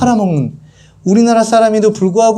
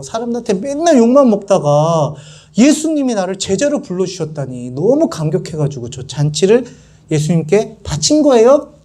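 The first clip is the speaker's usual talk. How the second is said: normal talking voice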